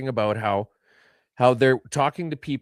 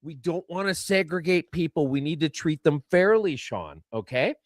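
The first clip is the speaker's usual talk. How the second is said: ordinary talk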